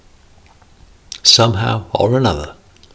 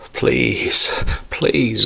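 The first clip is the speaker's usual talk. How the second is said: high, pleading cry to God; pleading prayer; desperate pleading; 5 semitones higher than usual. Acted. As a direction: beg with rising despair. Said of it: "he wailed".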